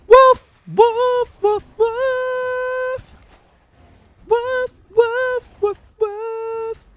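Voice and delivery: high, loud yapping barks; dog barking. Pretend. Streamer barking back at the dog to join the duet, high yaps. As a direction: yap like a little dog joining the song. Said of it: "Triceratops dog, join the duet".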